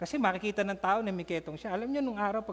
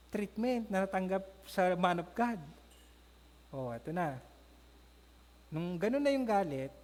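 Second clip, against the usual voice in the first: usual speaking voice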